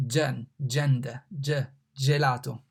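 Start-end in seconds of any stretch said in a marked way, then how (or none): 0.1-1.2 gen in gender said slowly as jen. Shown as a demonstration. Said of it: "pronounced like G in gender".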